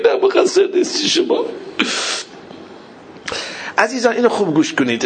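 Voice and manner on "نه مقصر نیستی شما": very high, tearful cry; wailing consolation. Retold or imitated. Consoling Abbas that he is blameless, tearful wail. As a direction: wail it through tears.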